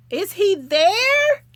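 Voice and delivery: high, slow, loud calling out; loud high call to someone gone to bed. Real, not acted. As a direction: call out for Matthew across the room. Calling out for absent Matthew.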